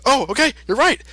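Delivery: high, eager agreeing; Goku voice. Retold or imitated. Goku agreeing with the Kai, in a high eager voice. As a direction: chirp it like a naive hero. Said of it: "Goku's like".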